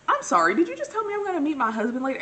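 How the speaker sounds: high, loud incredulous outburst; acted disbelief. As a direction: demand an answer as if stunned. Acts out her shocked reply to friend.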